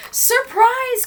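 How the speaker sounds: loud, very high shout of surprise; gleeful cry. A narrator's reading. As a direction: burst out high and loud, pouncing. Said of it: "cried the wolf".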